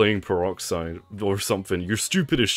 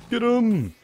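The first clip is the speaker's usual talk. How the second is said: high-pitched attack call; excited urging; 13 semitones higher, 3 dB louder than usual. Pretend. Player sends the NPC after the enemy, excited urging. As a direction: cry it out high, like sending in troops.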